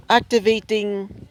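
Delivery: high mechanical computer voice; robot voice. Acted. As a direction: announce it like a training machine. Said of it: "mechanical voice says this".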